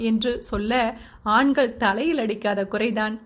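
usual speaking voice

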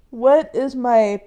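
normal talking voice

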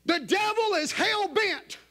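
high, loud fiery preaching; preaching at full force. Acted. Preacher stirring up the church, loud and high. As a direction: shout it out to the whole church.

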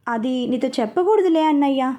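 ordinary delivery, nothing marked

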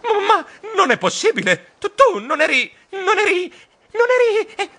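high, stuttering panicked voice; terrified stammer. Acted. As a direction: stammer in terror. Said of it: "Terrorizzato balbettai".

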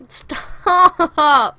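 loud, high drawn-out shout; sudden yell. Real, not acted. Yelling at a game enemy.

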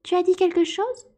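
high, stiffly polite mouse voice; polite on the surface, annoyed beneath; polite but frowning mouse. A narrator's reading. Mouse questions the lory, high and stiffly polite.

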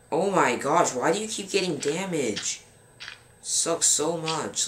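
high-pitched frustrated complaint; real exasperation, pitch swooping up; exasperated. Real, not acted. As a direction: complain up high at a hopeless teammate. Scolding teammate for getting damage.

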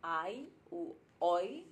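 Ai u oi drawn out very slowly, sound by sound; Bengali vowels. Shown as a demonstration. Teaching viewers the vowels, slow careful Ai u oi. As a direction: stretch out each sound in Ai u oi. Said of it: "lo usan más como oi".

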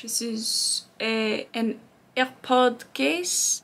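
slow put-on French accent for AirPod case. Shown as a demonstration. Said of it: "Everything sounds better in French".

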